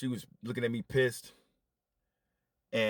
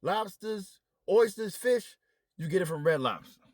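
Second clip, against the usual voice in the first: higher, louder exasperated list; irritated higher voice listing seafood; mock irritated rant; 8 semitones higher, 4 dB louder, slower than usual. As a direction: rattle it off, fed up. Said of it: "So now I'm pissed".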